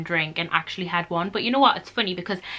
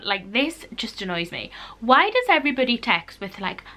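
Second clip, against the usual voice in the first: ordinary delivery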